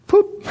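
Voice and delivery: high popping mouth noise; a silly pop; mock pop sound; comic poop sound.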